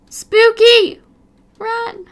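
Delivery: high shrieking spooky yell; mock scream. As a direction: shriek it like a scared kid. Lightning flash, mock fright.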